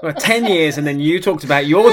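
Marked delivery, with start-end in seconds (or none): none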